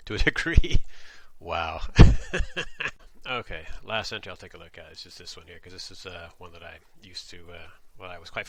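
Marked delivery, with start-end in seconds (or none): 1.9-3.2 high, loud breathy laughter; laughing hard. Real, not acted. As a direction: crack up again. Laughing after the demo.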